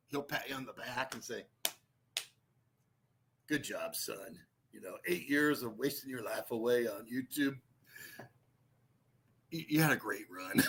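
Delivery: lightly voiced patronizing father; mock father. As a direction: play a condescending dad, low and soft. Voicing Billy Blue's dad.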